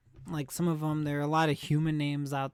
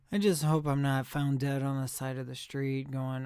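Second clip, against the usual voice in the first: usual speaking voice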